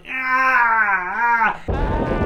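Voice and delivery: loud, high, long held "ah"; imitated wail. Imitating the singer in the boat, loud high wail. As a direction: belt out a mock wailing note. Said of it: "then he starts going".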